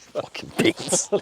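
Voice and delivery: slow breathless whisper through amusement; a laughing whisper to a friend at his joke; whispered aside. Real, not acted. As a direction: hiss it under your breath, amused.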